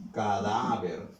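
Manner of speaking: "cadáver" said alone, lengthening DÁ; stress on DÁ. Shown as a demonstration. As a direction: stretch the middle syllable of "cadáver", then fall. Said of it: "suena más fuerte aquí".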